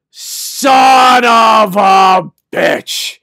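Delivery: loud, high, long drawn-out yell; outraged shout. Retold or imitated. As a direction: bellow it slowly as the truth hits. Reliving his sudden realization.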